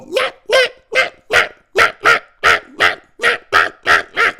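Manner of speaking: repeated yappy mock puppy barks; imitated puppy barking. Girlfriend's puppy penned behind a little fence, imitated incessant yapping. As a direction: yap over and over like a penned pup. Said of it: "and it would just go".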